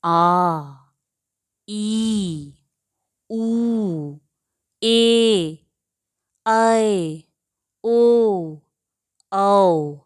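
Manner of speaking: each long vowel held clearly: आ ई ऊ ए ऐ ओ औ; long vowels demo. Shown as a demonstration. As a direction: draw out आ ई ऊ ए ऐ ओ औ one by one. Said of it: "तो इन्हें बोलकर देख लेते हैं".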